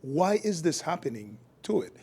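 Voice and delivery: usual speaking voice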